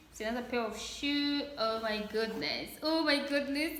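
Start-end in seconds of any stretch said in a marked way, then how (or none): none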